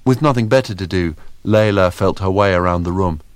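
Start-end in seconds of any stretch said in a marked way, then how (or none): none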